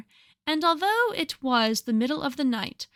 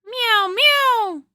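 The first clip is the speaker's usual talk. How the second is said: loud, high drawn-out meow; cat meowing; 10 semitones higher, 8 dB louder than usual. Acted. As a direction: cry like an impatient cat. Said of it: "interrupted Simpkin".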